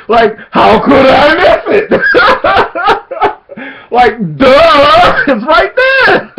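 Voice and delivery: incredulous laughing yell; laughing outburst. Real, not acted. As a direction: burst out laughing at the obviousness.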